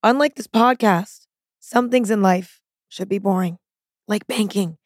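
punchy advert opening; ad read. Acted. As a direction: sell it with a bright hook. Second sponsor read.

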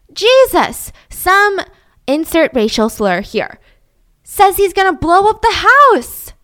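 high, loud, alarmed voice; panicked son. Acted. Son telling his father about a bomb threat, high and panicked. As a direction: blurt it out in a panic.

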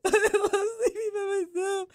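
put-on very high, whiny, tearful little girl voice; imitating little daughter. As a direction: mimic a crying toddler repeating it. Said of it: "And she's like".

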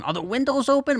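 put-on high-pitched fussy old grandma; fretting grandma. Grandma in the car in pouring rain, fretting.

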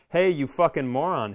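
ordinary talk

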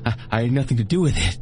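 high, stammering, rushing at the end; frantic denial. Acted. Copperhead pleads his innocence to RJ, high and frantic. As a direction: blurt out excuses in panic. Begging, denying guilt.

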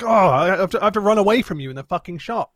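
mock her loudly, full of scorn; sarcastic mock outrage. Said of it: "And it's like".

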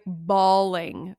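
ordinary talk

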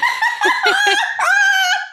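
cracking up at the call, high; high burst of laughter. Real, not acted.

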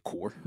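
"core" for car, with a rounded O. Shown as a demonstration. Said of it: "I would never say core".

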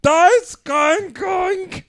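high, very slow fake German bark; mock German. Friend asked how Germans talk, answers in a high, slow mock German accent. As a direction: ham up a cartoon German officer. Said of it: "How do Germans talk?".

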